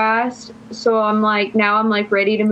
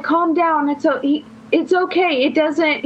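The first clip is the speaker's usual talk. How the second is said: mimicking the guy, pitched higher, calming her down; imitating him soothing; 6 semitones higher than usual. Coworker calming her shocked reaction: higher, mock-soothing. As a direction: play him fumbling to settle her down, voice up high.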